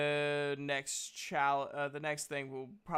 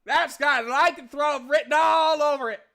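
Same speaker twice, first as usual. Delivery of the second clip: loud, high-pitched, hammed-up alarm; alarmed game character; 12 semitones higher, 12 dB louder than usual. Game master's character sees the bite marks, loudly alarmed. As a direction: blurt it out big in character.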